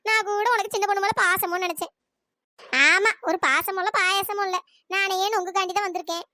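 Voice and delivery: ordinary talk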